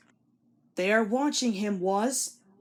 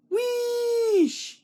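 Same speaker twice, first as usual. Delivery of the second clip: high, loud whistling wheesh; train whistle; 14 semitones higher, 4 dB louder than usual. A narrator's reading. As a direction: whistle like an engine in a tunnel. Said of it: "he whistled".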